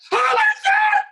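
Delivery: screaming high and loud with joy, freaking out; ecstatic lottery winner. Pretend. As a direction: yell it like you just won millions. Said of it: "or is it gonna be something like".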